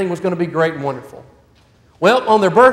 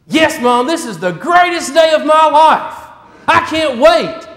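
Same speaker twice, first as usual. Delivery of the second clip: excited son shouting; 6 semitones higher, 3 dB louder, slower than usual. Acted. Optimistic son exults in room.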